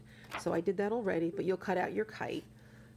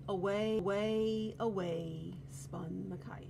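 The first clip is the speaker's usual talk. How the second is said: plain reading voice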